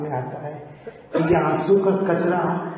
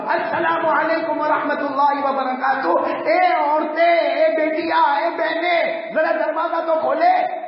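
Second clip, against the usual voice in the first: calling out; 10 semitones higher, slower than usual. Retold or imitated. Prophet calling out to the women behind closed doors.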